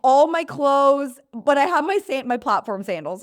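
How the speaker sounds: patient asked to carry everything, mock incredulous; mock surprise. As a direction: stretch it out in disbelief. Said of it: "I'm like".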